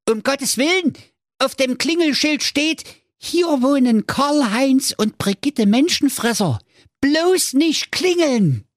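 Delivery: put-on high panicked kids; shrill alarmed little voices; frightened children. (hohe panische Kinderstimmen)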